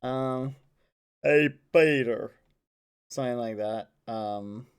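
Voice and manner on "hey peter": loud put-on cartoon greeting; Joe Swanson. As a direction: call out to Peter in character. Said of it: "It's Joe Swanson".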